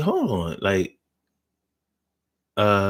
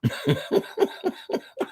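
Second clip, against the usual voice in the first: high breathy laugh; 7 dB quieter than usual. Real, not acted. Laughing at own line.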